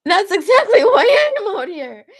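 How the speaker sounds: high, loud, laughing reply; excited laughing quote. Retold or imitated. Crush says he came out hoping to meet her, laughing. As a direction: laugh it out, thrilled at the coincidence. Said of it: "And then he was like".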